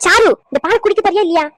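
loud, high scolding ultimatum; shouting. Acted. Grandmother forcing milk on a reluctant girl, shouting. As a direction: shout at the child to obey right now. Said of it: "ஏன் கத்துறீங்க?".

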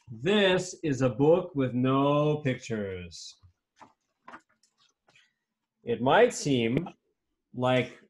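slow, deliberate storybook reading; measured reading with long pauses; read-aloud to children. Acted. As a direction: draw out the words for young listeners. Reads picture book aloud.

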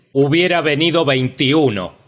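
high, forceful outburst; emphatic acted shout; loud retort. Uncle's stubborn comeback to sister.

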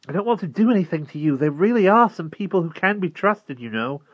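put-on high, loud, quick boy's protest; earnest protest. A narrator's reading. Boy protests his good intentions.

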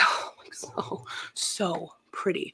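sighing, slow awed whisper. Real, not acted. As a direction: breathe it out slowly. Sighing over earrings.

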